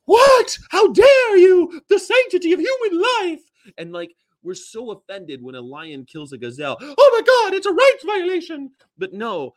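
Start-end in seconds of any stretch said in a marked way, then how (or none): 0.0-3.4 high, loud, scandalized gasping cry; hammed-up horror, pitched way up; mock outrage. Acting out people's outrage at a murder. 6.8-8.7 high, loud, hammed-up alarm; over-the-top horrified squeal; mock outrage. Mock outrage at lion killing gazelle.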